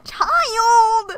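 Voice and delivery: high cackling scream; laughing shriek. Real, not acted. Gamer shrieks laughing at the character.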